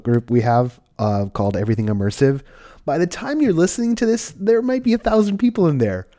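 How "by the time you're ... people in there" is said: bright, beaming, much higher pitch; smiling voice. Real, not acted. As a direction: grin through the words, pitched high. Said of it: "hear the smile in my voice".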